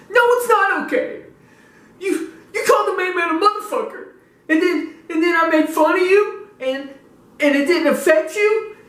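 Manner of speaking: very high, squeaky mock-whining voice; whiny upset fans. Offended fans complaining they were ignored, mock whining. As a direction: whine like a wounded fan, hammed up. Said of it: "they'll be more like this".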